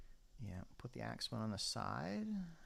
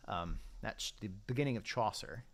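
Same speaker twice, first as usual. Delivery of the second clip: ordinary talk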